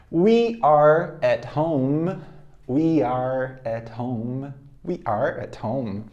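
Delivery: drawn-out, deliberate repetitions; very slow drill. Shown as a demonstration. Teacher drills the sentence very slowly for students. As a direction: drag each word out for learners. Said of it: "slow drill repetitions".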